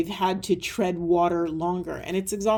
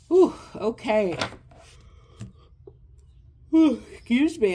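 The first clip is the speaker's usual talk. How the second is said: words stretched out through a real yawn, higher; 4 semitones higher, 4 dB louder than usual. Real, not acted. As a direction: let the yawn carry the words. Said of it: "I don't know why I'm yawning".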